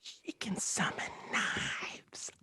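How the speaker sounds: slow, drawn-out whisper; stage whisper. Pretend. Player slipping in a secret about her, whispered. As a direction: whisper it slowly like a secret warning.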